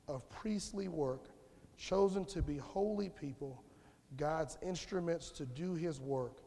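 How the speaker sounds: slow, measured Bible reading; scripture reading. Acted. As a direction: read the verse slowly, letting each phrase land. Reads scripture aloud.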